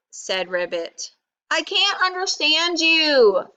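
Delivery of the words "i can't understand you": high, frustrated storybook shout; raised pitch, exasperated. A narrator's reading. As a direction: shout it at someone you can't follow. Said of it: "shouted Rabbit".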